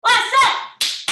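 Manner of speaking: loud, high cheer call; shouted call. Real, not acted. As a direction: yell it to rally the class. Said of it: "When I say last set".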